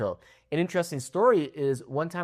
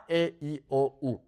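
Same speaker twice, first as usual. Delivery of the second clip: ordinary delivery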